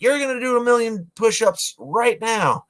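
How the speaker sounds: high, barked drill sergeant order; drill instructor. Retold or imitated. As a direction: bark it like a military drill instructor. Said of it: "the drill instructor comes up and is like".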